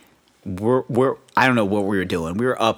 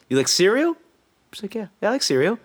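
mimicked exchange; 7 semitones higher, faster than usual. Retold dad-kid exchange.

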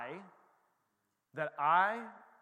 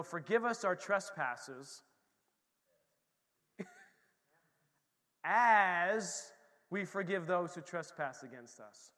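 reciting scripture; slower than usual. Acted. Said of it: "it says".

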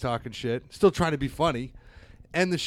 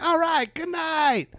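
mock chipper; slower than usual. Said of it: "I was like".

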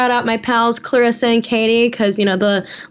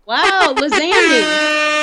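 teasing; 7 semitones higher, much slower than usual. Pretend. Teasing the name Andy.

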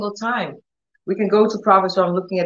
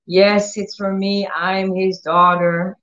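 ordinary talk, nothing marked

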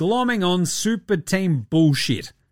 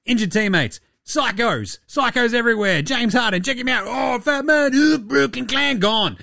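higher, gloating voice of the gods laughing at him; pitched-up taunting sing-song; mocking basketball gods; 6 semitones higher than usual. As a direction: rub it in like cackling gods. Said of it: "And they're like".

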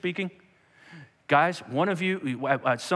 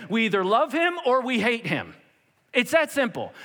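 high, emphatic declaration; raised-voice uncompromising verdict; 8 semitones higher, 3 dB louder than usual. Acted. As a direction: insist there is no middle ground.

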